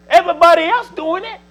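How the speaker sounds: high, loud whiny protest; high and loud, a child's everybody-does-it whine; kids' excuse. Acted. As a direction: throw the excuse out loud like a kid. Preacher voicing kids' excuse.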